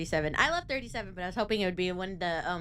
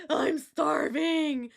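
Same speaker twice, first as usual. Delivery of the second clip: dramatic whine; 7 semitones higher, 5 dB louder, much slower than usual. Retold or imitated. Dramatic complaint after meal.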